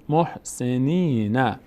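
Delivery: محسنین slowly, "nee" held; long vowel. Shown as a demonstration. Teacher showing nun before ya, long vowel. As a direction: stretch the ee in نی of محسنین. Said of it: "صدای ای".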